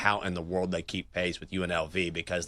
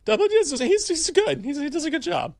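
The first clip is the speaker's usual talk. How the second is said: much higher, louder, squeaky reply; playing up the high voice; 6 dB louder than usual. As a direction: lean into the squeaky pitch for a laugh. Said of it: "when people's voice goes really high".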